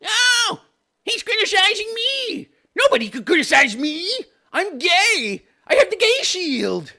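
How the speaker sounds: high, hammed-up mock wail; squealing mock outrage; triggered whiner. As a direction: wail it like a wounded crybaby. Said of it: "he just got triggered".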